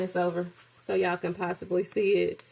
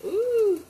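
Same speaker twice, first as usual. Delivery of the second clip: high, louder ooh of delight; 13 semitones higher, 5 dB louder than usual. Real, not acted. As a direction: let out a pleased high ooh at the taste. Tasting her own dish.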